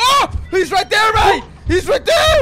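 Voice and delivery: screaming in panic, laughing; panicking gamer. Real, not acted. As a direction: yell a frantic warning. Monster spotted, shouting.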